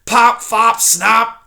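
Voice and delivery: put-on loud, cranky old porcupine; loud scornful old porcupine; cranky porcupine cry. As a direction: call out the wrong names with scorn. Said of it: "Erith cried".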